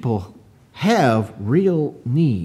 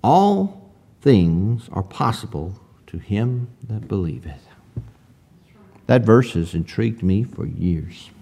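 solemn hush; 7 semitones lower, 7 dB quieter than usual. Real, not acted. Preacher putting a searching question to the congregation, solemn hush. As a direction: let the verse sink in gently.

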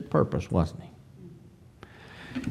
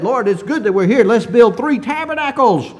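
rising, louder and higher as Peter speaks up; bold exclamation; 8 semitones higher, 6 dB louder than usual. Retold or imitated. Preacher voicing Peter boldly proposing three tabernacles, loud and high. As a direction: Peter bursting out eagerly, louder with each tabernacle. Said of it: "boldly said".

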